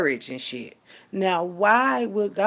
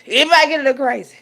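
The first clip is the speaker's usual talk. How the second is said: worked up; 6 semitones higher, 8 dB louder, faster than usual. Real, not acted. Louder, faster echo.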